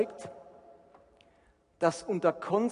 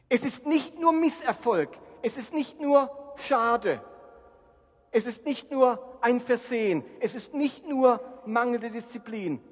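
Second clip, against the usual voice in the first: preaching at full force; 6 semitones higher, 6 dB louder than usual. Acted. Preacher's hammering list.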